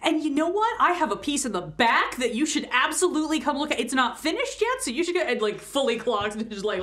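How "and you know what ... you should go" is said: high-pitched, pushy sales patter; eager salesman. Acted. Employee steering a customer to the back, in an eager high voice. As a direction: talk up a piece in an excited rush of pitch. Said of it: "Johnny over the corner is just like".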